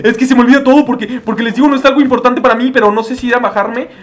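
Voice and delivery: high-pitched exasperated explaining; flustered complaint. Real, not acted. Flustered at forgetting the way. (queja aguda y agobiada)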